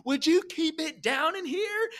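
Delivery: high whiny imitation of an irritated man; annoyed neighbor. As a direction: imitate the fussy neighbor from next door. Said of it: "comes in and goes".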